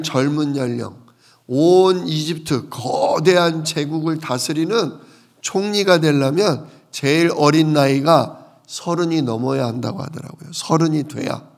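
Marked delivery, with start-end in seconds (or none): none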